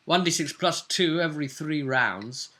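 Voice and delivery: normal talking voice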